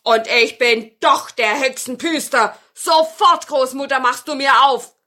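loud, insistent villain order; wizard shouting. Acted. Wizard orders grandmother to open up, loud insistent shout. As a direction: shout a demand at the door. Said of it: "Da schrie der Zauberer".

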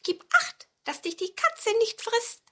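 hohes Mäusestimmchen; Maus. A narrator's reading. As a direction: als kleine Maus ganz hoch sprechen. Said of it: "sagte die Maus". (piepsige Mäusestimme)

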